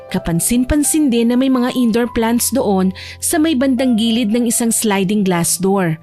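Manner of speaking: ordinary delivery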